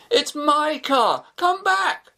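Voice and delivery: high, slow desperate call; yelling after them. A narrator's reading. Shafi calling after the car speeding away, desperate yell. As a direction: shout down the road after the thief. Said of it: "No good yelling after 'em".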